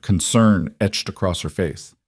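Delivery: ordinary delivery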